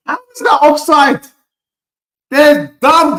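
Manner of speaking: loud, high indignant protest; outraged protest. Real, not acted. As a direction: rage at the referee's call. Goal ruled offside, fan protests.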